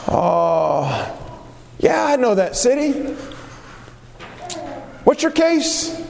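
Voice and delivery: butter up the stranger, all interest; sly politician leaning in; Absalom flattering. Acted. Preacher voices Absalom flattering petitioners.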